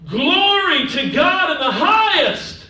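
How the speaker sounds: loud, high, slow proclamation; ringing declaration, drawn out; angels' proclamation. Acted. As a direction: proclaim it like a heavenly host. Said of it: "They were saying".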